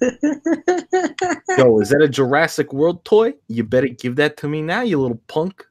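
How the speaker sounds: hammed-up tough-guy character voice; Matthew Brando. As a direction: demand it like a cartoon bully. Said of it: "the character I've created for him".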